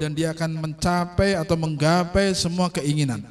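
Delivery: ordinary delivery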